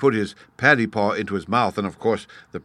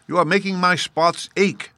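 high, fed-up jaguar whine; exasperated jaguar; 9 semitones higher than usual. A narrator's reading.

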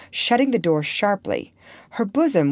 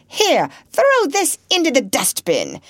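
high, emphatic order, pointedly articulated; deliberate command; 8 semitones higher, 4 dB louder than usual. A narrator's reading. Lady ordering her maid for a listener's benefit, deliberate. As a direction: order it pointedly, meant to be overheard. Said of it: "she seemed to pronounce with intentional deliberateness".

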